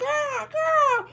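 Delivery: shrill bird screech; mock bird screech; high squawk. Bird cry.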